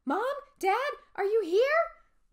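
high, loud anxious call, slow at the end. Acted. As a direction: call into the dark house, hoping. Calling for missing parents.

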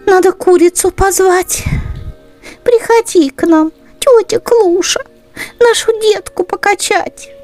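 high, quick mouse-mother voice. Acted. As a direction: call the hen in a high, hurried mouse voice. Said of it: "Стала думать мышка-мать". (мама-мышка, быстро и высоким голоском)

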